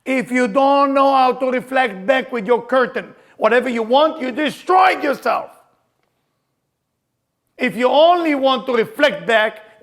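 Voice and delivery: loud, high, slow sermon cry; fiery preacher's call; preaching at full force. Acted. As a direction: preach it out to the room, slowly.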